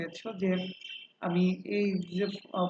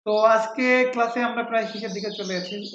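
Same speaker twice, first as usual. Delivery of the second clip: ordinary delivery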